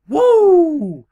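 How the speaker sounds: huge high shouted woo; Ric Flair woo. Retold or imitated. Flair struts off after putting down Corbin, screaming woo. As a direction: belt out the signature woo at the top of your lungs. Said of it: "And Ric Flair goes".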